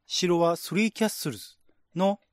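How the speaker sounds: ordinary delivery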